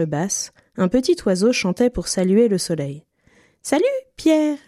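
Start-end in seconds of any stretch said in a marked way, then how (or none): 3.6-4.6 high, piping little bird voice; whistling little bird. A narrator's reading. Little bird greeting Pierre from its branch, high and piping. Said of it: "lui siffla-t-il".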